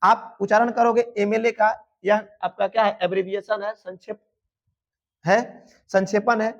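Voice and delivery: ordinary delivery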